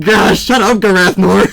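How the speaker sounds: loud annoyed scolding; exasperated shout. Pretend. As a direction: tell him off loudly. Scolding the dragon character.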